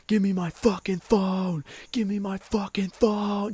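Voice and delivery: high, slow, furious mock scream; yelling high and drawn out at a little kid; retold scream. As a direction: scream it in the kid's face. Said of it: "he was screaming in my face".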